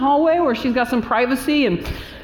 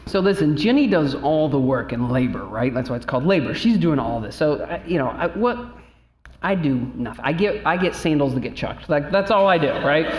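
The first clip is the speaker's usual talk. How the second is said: ordinary talk, nothing marked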